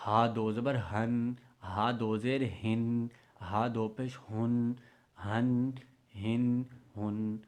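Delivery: ہن read heavy from the bottom of the throat. Shown as a demonstration. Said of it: "حلق کی تہ سے پڑھنا ہے".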